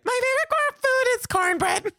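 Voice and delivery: very high, loud imitation of a little kid; exaggerated Southern kid voice, squeaky high; Southern four-year-old. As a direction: pipe up like a small Southern child. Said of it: "so Southern to hear a four-year-old".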